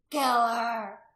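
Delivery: loud, rough cry for blood; mob shout. A narrator's reading. Man in the crowd demanding death, shouting.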